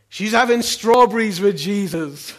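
loud, high, drawn-out joyful cry; big jubilant proclamation, stretched out; triumphant shout. Retold or imitated. Triumphant punchline answer to the kids.

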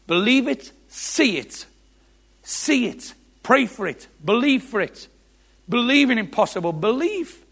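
high, loud preaching commands; rousing exhortation. Real, not acted. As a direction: punch out each command, voice climbing. Preacher exhorting the listeners to believe.

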